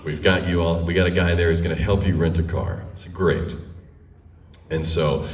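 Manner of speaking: ordinary talk, nothing marked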